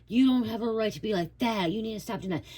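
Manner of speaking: high, lightly voiced scolding person; mock scold telling the listener they have no right; mocking a scolder. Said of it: "Someone's saying".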